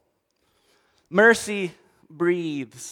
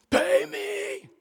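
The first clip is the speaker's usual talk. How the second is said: strained menacing demand. Acted. Servant choking debtor.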